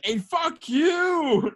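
put-on high, slow drunken yell; drunken scream. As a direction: scream it out the car window. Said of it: "screams at the cop".

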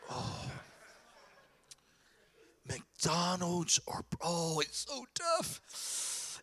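mock anguish, sighing and dragging the words out; agonized indecision. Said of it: "Here's some of you".

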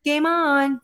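high, drawn-out "game on"; imitated catchphrase. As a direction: sing it out as he does. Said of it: "That's what he says".

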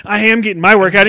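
snippy retort. Retold or imitated. Girl filming snapping back at the gym-goer, quick. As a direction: fire it back fast and sharp. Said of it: "And she's like".

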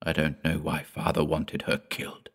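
soft, subdued voiced murmur; quiet, understated grief; whispered. A narrator's reading. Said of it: "Peter whispered".

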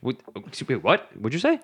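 ordinary delivery

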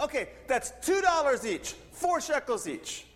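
high-pitched local naming his price; man across street. Retold or imitated. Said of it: "the man across the street goes".

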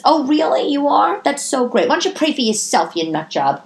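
high, mock-delighted sarcasm turning snide; sarcastic comeback. Snapping back at a praying acquaintance, sarcastic. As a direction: fake enthusiasm, then the jab.

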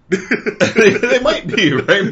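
high, loud, laughing concession; amused, voice jumping up loud. Real, not acted. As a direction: talk through a burst of laughter. Laughing at co-host's joke reply.

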